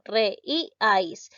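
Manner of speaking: "Re, i, ais" split into three separate syllables. Shown as a demonstration. Teacher shows how to split the word into syllables, syllable by syllable. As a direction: break it up: "Re", "i", "ais", a small gap between each. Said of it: "¿cómo lo silabeo?".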